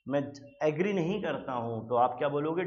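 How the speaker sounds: ordinary talk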